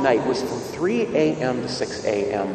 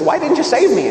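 indignant question; 12 semitones higher, 5 dB louder than usual. Retold or imitated. Drowned man complains to God.

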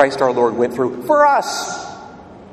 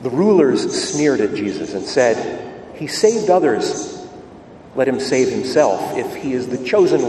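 measured, solemn reading of scripture, a little lower and softer; Gospel proclamation; 6 semitones lower, 6 dB quieter than usual. Acted. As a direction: priest reading the Gospel aloud at Mass.

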